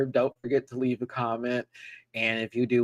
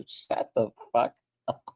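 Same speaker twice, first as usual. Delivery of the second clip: ordinary talk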